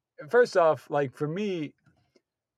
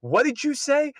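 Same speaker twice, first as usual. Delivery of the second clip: high, indignant challenge; mock outrage, pitched way up; retold telling-off; 10 semitones higher than usual. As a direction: imitate a girlfriend confronting him. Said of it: "she gave it to him".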